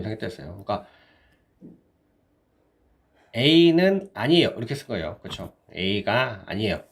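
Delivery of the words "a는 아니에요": A는 punched high, loud and slow; stress on A. Shown as a demonstration. Teacher explaining not so much, stressing A는. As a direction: lean hard on A는.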